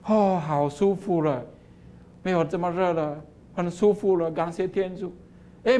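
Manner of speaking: ordinary delivery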